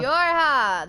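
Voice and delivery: loud, high call of a name. Real, not acted. Girlfriend loudly calling out to her friend.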